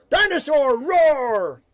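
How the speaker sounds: loud roaring chant; dinosaur roar. Pretend. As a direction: belt it like a dinosaur.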